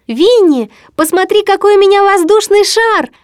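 put-on high, excited boyish call; joyful shout. A narrator's reading. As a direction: call out in delight to show a friend. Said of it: "радостно прокричал ему Кристофер Робин".

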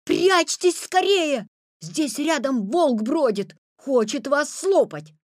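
loud, high, drawn-out alarm; shouted warning. A narrator's reading. Petya warning grandmother about the wolf, loud slow shouting. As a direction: cry the warning from the doorway. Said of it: "прямо с порога закричал".

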